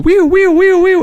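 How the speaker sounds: very high whooping siren wee; imitated alarm siren. Telling the co-hosts about the alarm, mimicking a high siren. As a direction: squeal it like a house alarm.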